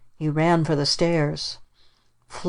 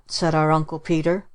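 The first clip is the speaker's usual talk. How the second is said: plain reading voice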